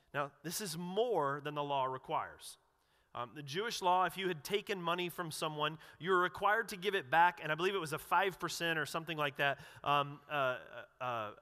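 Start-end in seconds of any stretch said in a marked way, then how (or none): none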